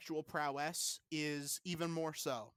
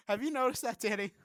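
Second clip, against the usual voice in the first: high, loud challenge; sudden confrontation; 7 semitones higher, 6 dB louder, faster than usual. Acted. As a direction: fire the question loudly at your co-host. Mad speaker suddenly confronts the co-host.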